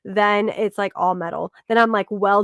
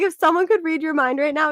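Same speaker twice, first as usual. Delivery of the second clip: normal talking voice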